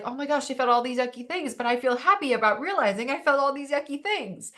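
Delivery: high, loud, gushing mock relief; happy relief. Breath-work student realizing what she felt, high and gushing. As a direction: gush like you just found something out about yourself. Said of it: "even if it's like".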